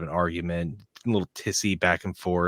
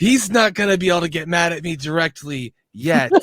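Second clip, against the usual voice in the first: put-on old New York man voice; 8 semitones higher, 7 dB louder than usual. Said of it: "if I start talking like this".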